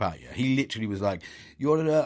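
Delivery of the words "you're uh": higher, put-on Turkish accent. Barber greeting a customer, imitated Turkish accent. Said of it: "I shouldn't do an accent".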